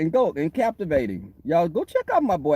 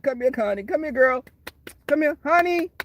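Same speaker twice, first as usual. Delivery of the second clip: higher and higher sweet coaxing call; 8 semitones higher than usual. Retold or imitated. Retelling how she called the pigs in, coaxing. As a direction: coax the animal over to you. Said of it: "I yelled calling the pigs in".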